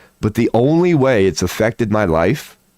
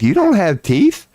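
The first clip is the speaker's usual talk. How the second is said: surprised coworker; 11 semitones higher than usual. Retold or imitated. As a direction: caught off guard by the news. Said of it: "And they're like".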